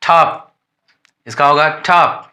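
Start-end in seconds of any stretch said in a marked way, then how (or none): 0.0-0.5 ठॉप said with a breathy aspirated ठ at the start; teacher demonstrating the aspirated t in top. Shown as a demonstration. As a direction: puff of air after the t in ठॉप. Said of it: "टी का pronunciation क्या होगा?".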